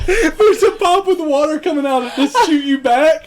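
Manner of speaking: words broken by real laughter; laughing through the story. Real, not acted. Laughing as he tells friends about falling off the ride. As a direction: laugh while telling how you fell off.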